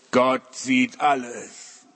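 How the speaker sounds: very slow, solemn, ghostly voice from nowhere; mysterious voice. Acted. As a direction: drag it out like an unseen voice in the dark. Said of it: "Und die Stimme sagt so". (sehr langsame, feierliche, geisterhafte Stimme)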